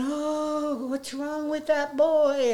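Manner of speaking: put-on high, slow, taunting child's cry of disgust; mocking children. Kids at the park jeering at Darren, high mocking voice. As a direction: mimic kids jeering at a boy, high and drawn out. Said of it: "the kids would begin screaming and saying".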